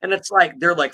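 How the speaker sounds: ordinary delivery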